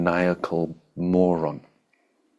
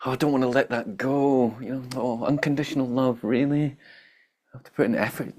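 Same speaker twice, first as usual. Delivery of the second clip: whiny voice; 5 semitones higher than usual. Retold or imitated. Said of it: "that whiny, bitchy, ungrateful voice".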